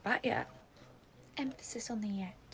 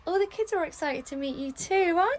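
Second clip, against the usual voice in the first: sing-song nursery voice; 6 semitones higher, 8 dB louder than usual. Acted. Nursery teacher addressing children.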